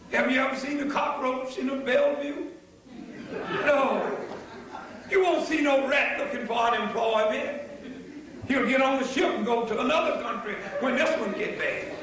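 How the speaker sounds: ordinary talk, nothing marked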